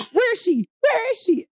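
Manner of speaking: high-pitched retold demand; mock angry demand. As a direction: imitate a sister hunting for someone. Sister runs to the door.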